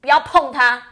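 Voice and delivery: loud greedy whine; possessive whining. Acted. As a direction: grab it all back, shouting. Teacher retells Pig's lines.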